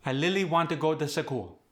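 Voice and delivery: Khmer accent, "really" as lily; Khmer-accented, extra vowel in "school". Shown as a demonstration. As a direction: soften R and split clusters, Khmer style. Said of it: "we'll have [name] say it".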